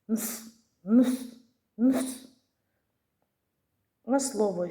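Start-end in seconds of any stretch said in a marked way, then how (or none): 0.1-2.3 "Нс" said as bare consonants, louder each time; no vowel. Shown as a demonstration. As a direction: push out "Нс" with no vowel. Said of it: "Спробуємо сказати його без голосного". (вимова "Нс" без голосного, все гучніше)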